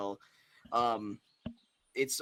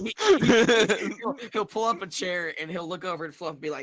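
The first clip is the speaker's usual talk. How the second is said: laughing; 8 semitones higher, 11 dB louder than usual. Real, not acted. GM laughing through narration.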